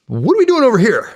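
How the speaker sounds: high, loud exasperated question. Retold or imitated. Soldier questioning the Iraq war, high and loud in disbelief. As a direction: throw up your hands in disbelief.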